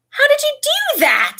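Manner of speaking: very high, loud, amazed squeal. A narrator's reading. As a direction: squeal it in delight. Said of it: "squealed Angelina Johnson".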